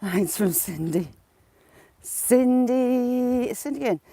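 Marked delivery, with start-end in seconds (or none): 2.0-4.0 drawn-out raised hail of a name; calling out. Real, not acted. Streamer greeting Cindy on the live, calling out. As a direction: stretch the name out as a call.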